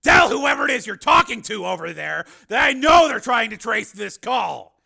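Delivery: high, loud, forceful command; angry order. A narrator's reading. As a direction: call out their trick at full volume. Steele confronts the stalling.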